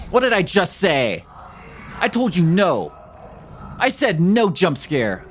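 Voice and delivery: loud, high, shaken scolding; scared horror game streamer. Real, not acted. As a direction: scold the game after the scare, slowly at the end. Scolding the game after jump scare.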